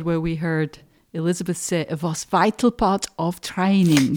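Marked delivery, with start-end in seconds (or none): 1.9-4.2 put-on Natasha Russian accent. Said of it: "We told her to model that on Natasha".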